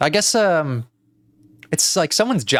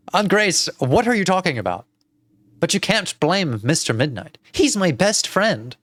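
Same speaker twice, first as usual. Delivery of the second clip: put-on higher, indignant girl voice; pleading protest, pitched up; 6 semitones higher than usual. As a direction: defend your best friend to your aunt. Plays the girl defending her cat.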